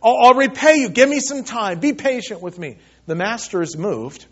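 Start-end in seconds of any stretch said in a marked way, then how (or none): 0.0-2.8 urgent, higher-pitched plea, loud at first then easing; desperate servant pleading, acted out. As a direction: beg for more time. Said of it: "pleads with them".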